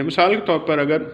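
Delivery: ordinary talk, nothing marked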